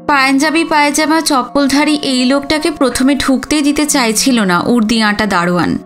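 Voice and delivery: ordinary delivery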